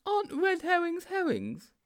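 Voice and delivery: high-pitched, naive sidekick; high storybook character voice; Sponge's character voice. A narrator's reading. Sponge's baffled question in story.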